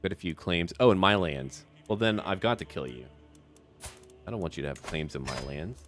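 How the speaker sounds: ordinary talk, nothing marked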